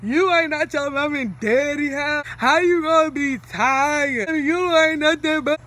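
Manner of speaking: slow, exaggerated scolding of a child; mock critic. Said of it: "Like, I probably say".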